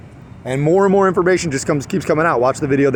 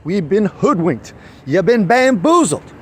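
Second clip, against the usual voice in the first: slow, raised orator's voice; Malcolm X; 5 semitones higher, slower than usual. Acted. Quoting a famous speech on being deceived, slow impassioned oratory. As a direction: draw out the words like a rousing speech. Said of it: "As Malcolm X said".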